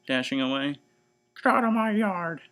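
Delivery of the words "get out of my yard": high, loud furious yell; angry shout. Acted. Eugenia chasing the pig out of her yard: angry shout. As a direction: chase the pig off yelling. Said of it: "shouted Eugenia".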